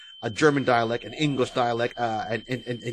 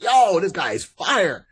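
higher, slow drawn-out hype; excited reaction; 6 semitones higher, 4 dB louder, slower than usual. Retold or imitated. Viewer hyping an actor after seeing the movie, excited. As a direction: re-enact being impressed, voice lifted. Acts out his reaction to film.

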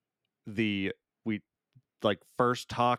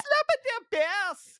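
very high, slow catchphrase squawk; Paul Rudd; 4 dB louder, slower than usual. Retold or imitated. Host keeps re-enacting Rudd's bit, absurdly high and stretched. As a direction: push the silly impression way up, drawn out. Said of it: "and he's just like".

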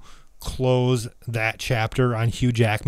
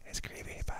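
barely audible whisper; 20 dB quieter, faster than usual. Real, not acted. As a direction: echo it back in a whisper. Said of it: "Why are we whispering?".

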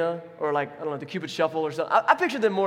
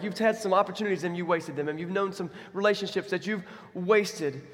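ordinary talk, nothing marked

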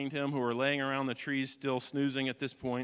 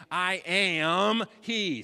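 slow emphasis; 5 semitones higher, 7 dB louder, much slower than usual. Acted. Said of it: "phrase repeated with emphasis".

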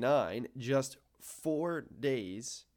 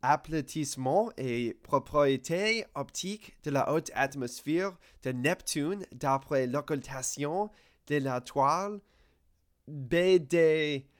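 French title read in his best French accent, higher; 3 semitones higher, 3 dB louder, slower than usual. Shown as a demonstration. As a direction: recite it in careful French. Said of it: "get into my, uh, best accent".

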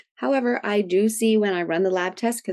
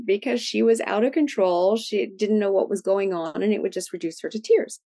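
normal talking voice

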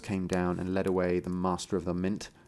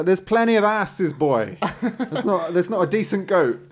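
high, loud jokey protest, laughing; mock grumbling father; 9 semitones higher, 5 dB louder than usual. Father missing his goat scolds his son, mock protest. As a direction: play the goat-obsessed father for laughs, pitched up.

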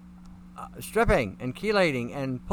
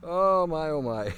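laughing; slower than usual. Real, not acted. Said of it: "I gotta get my composure back".

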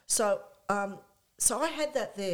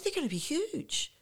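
imitating [name]; 9 semitones higher than usual. [name] predicts [name].

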